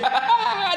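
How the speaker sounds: sharp high burst of derisive laughter; high scoffing laugh; scornful laugh. Real, not acted. Laughing at the critic.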